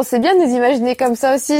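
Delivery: normal talking voice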